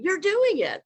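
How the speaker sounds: high, loud insistent teacher; acting teacher. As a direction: call her out like a teacher. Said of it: "an acting teacher told me".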